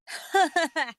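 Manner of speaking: put-on a high cackle from the old woman; wicked old woman's cackle; cackling laugh. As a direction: cackle over the loot. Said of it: "laughed the same woman".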